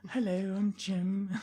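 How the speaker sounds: put-on light Manchester accent. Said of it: "your Manchester accent".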